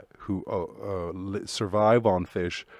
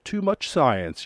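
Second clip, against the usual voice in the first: slow mock dismissal; 4 semitones higher, much slower than usual. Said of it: "And you're like".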